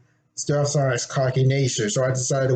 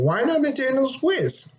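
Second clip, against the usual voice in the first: imagined viewer question; 11 semitones higher than usual. Retold or imitated. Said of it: "you gotta be asking".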